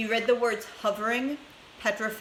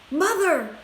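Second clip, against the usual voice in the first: higher and louder call to mum; boy calling his mother excitedly; excited cry; 7 semitones higher, 6 dB louder than usual. A narrator's reading. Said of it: "cried Charlie".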